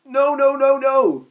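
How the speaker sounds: loud, high panicked cries; terrified shouting; very scared. Acted. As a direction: beg in terror. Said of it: "Snaptrap said, very scared".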